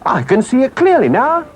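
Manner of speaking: high-pitched call from the engine driver. Acted. Engine driver reporting the pursuers to Toad, calling out high. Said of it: "the engine driver called out".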